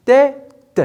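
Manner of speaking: "Tête" high and split as tê, pause, te; syllable by syllable. Shown as a demonstration. Teacher demonstrating syllables to pupils, "Tête" split tê... te. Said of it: "deux syllabes".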